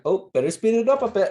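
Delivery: high, quick nervous Ned; hurried little worry, sped up; imitating Ned. Ned hearing a wolf howl on his walk, nervous. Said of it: "he's like".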